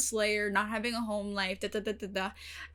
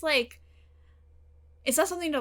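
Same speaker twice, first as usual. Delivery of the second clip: ordinary delivery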